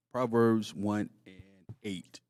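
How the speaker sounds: slowly, each word spaced out; slow and deliberate. Shown as a demonstration. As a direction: spell out the reference slowly. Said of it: "go to Proverbs real quick".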